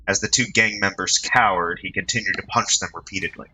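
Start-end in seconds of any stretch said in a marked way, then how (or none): none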